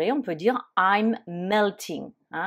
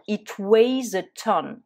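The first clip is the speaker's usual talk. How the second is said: slow, louder, words spaced out; slow model phrase; 3 semitones higher, 4 dB louder, slower than usual. Shown as a demonstration. Teacher modelling the phrase slowly and louder for students. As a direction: present it slowly for learners. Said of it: "on va dire".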